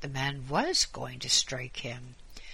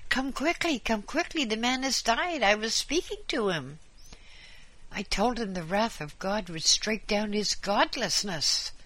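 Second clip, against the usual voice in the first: panicked priest; 8 semitones higher than usual. A narrator's reading. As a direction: cry out for help in high panic. Priest panics calling for help.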